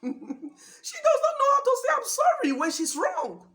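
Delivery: high, loud, laugh-filled exclamation; laughing incredulously. Real, not acted. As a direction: burst out laughing and cry it out. Laughs mocking wives who never apologise.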